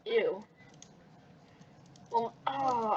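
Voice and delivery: plain reading voice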